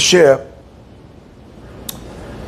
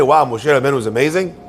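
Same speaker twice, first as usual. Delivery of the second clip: mock admiration; 7 semitones lower, faster than usual. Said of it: "we look at Moshe Rabbeinu and say".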